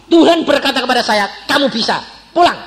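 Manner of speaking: high, loud dismissal; firm dismissal. Retold or imitated. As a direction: send them home with force. Pastor sends couple home.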